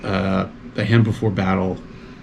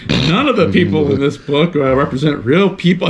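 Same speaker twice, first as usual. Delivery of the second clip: imitated sarcastic narrator; 5 semitones higher than usual. Podcaster imitating the narrator reading the book's disclaimer, lightly sarcastic. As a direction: lightly put on a sarcastic audiobook read. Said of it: "he's got this so, like, sarcastic".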